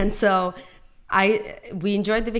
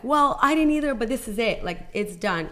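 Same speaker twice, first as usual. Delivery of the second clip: high, louder snap back at her husband; defiant retort; 4 semitones higher, 4 dB louder than usual. Retold or imitated. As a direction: cut him off, final.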